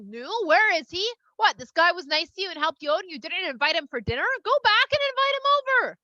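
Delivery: rising to a high, loud order; exasperated father. Retold or imitated. Father scolding daughters for not inviting their helper, exasperated. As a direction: scold them, building to a shout.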